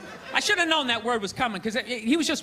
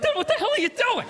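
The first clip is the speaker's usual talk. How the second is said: high, startled and half laughing; alarmed; 10 semitones higher than usual. Retold or imitated.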